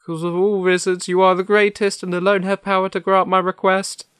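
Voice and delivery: very high, loud lion plea; pleading cowardly Lion. Acted. The Lion answers Oz, pleading and high. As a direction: beg urgently in a high voice.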